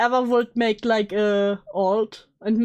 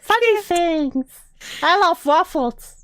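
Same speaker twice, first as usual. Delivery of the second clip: uwu voice; 6 semitones higher, slower than usual. Pretend. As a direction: coo it like a pretend uwu streamer. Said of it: "I would constantly use the Uwu voice".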